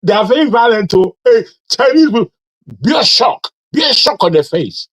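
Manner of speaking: high, loud mocking voice of an old Nigerian auntie; imitating auntie. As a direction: ham up a scandalised auntie, pitched high. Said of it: "an auntie of mine said".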